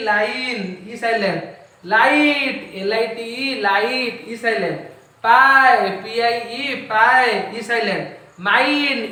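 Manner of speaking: rhythmic classroom drill, word loud and drawn out, spelling plainer; teacher modelling words for repetition; phonetic drill. Shown as a demonstration. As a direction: call out each word, spell it, repeat it. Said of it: "phonetic drill ସାଉଣ୍ଡକୁ".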